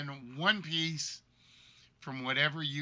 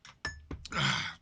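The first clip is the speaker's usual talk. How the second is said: tired sigh. Real, not acted. Sick, worn out.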